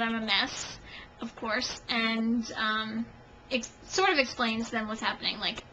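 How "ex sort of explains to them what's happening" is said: ordinary talk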